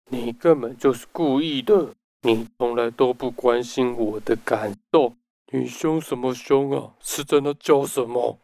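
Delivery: lightly acted angry reproach; mock hostile accusation. Book narrator voicing examples of hurtful language, mildly accusing. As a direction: act out mild verbal aggression, accusing. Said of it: "一些暴力型的语言".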